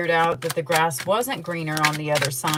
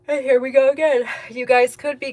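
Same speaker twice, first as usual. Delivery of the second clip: words stretched out by a big yawn, higher and louder; talking through a yawn; 7 semitones higher, 6 dB louder than usual. Real, not acted. Tarot reader to her viewers, mid-yawn. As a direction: yawn right through the sentence. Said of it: "Here I go again, yawning".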